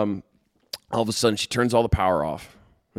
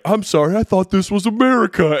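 loud, high imitation of a yelling fan; mock yelling; 10 semitones higher, 8 dB louder than usual. Teller quoting his hockey game post, mock indignant yell. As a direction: yell it like a cartoon dad ranting at a game. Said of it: "two fans yelling at each other".

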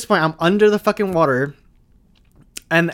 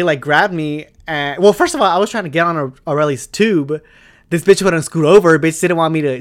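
ordinary talk, nothing marked

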